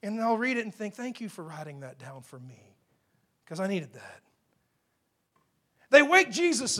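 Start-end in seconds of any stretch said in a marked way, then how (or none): 0.9-4.2 low, soft aside; grateful thought. Retold or imitated. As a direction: drop low and quiet, as if thanking privately. Voicing own grateful thought.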